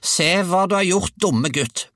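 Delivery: high, loud scolding screech; grandmother's roar. A narrator's reading. Grandmother blames boy for sick hen, high roar. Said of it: "brølte bestemor".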